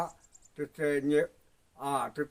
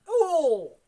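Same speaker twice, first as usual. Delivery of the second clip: high call; 13 semitones higher, 6 dB louder than usual. Real, not acted.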